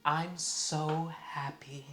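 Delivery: very slow, drawn-out words, acted; deliberate gloating. Woman reacting to Trump shooting news, imitated slow gloating. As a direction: imitate her, stretching out each word. Acting woman's provocative answer.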